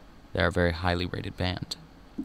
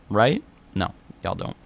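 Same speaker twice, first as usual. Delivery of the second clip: normal talking voice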